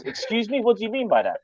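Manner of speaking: quiet director. Retold or imitated. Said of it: "then he goes".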